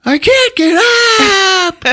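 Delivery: hammed-up tearful wail, stretched at the end; mock crying kid. Fallen little boy calling his brother, mock bawling. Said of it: "now he's really crying".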